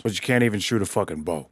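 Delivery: ordinary talk, nothing marked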